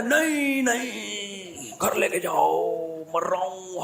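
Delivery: high, slow drawn-out moaning no; mock dying refusal. Injured man begs friends to take him home, drawn-out moaning.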